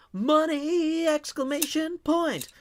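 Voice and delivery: very high, drawn-out cry; excited shout-out. Acted. Streamer reading a fifteen dollar tip message, excited shout-out. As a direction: stretch it out high and gleeful.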